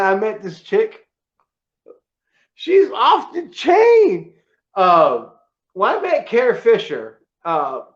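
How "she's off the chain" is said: fighting back real tears, slow; real tearful crack; choking up. Real, not acted. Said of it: "I'm gonna get fucking crying here".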